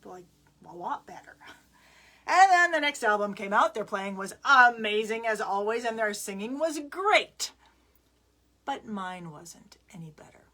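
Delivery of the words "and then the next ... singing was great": louder, raised, exclaiming delivery; emphatic storytelling climax. Real, not acted. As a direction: burst out with the big news. Storyteller peaks after repeated album list.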